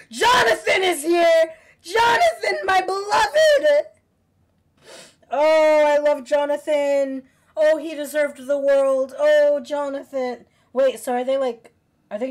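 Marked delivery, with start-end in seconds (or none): 0.1-3.9 high, very loud squealing cheer; ecstatic fan shout. Real, not acted. Fan greeting beloved Jonathan, ecstatic shouting. Fan sees favourite character appear. 5.3-10.4 loud, higher-pitched wailing fondness; gushing adoration. Real, not acted. Fan gushing over Jonathan, loud adoring lament.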